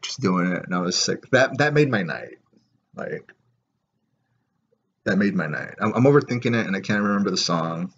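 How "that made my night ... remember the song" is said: ordinary delivery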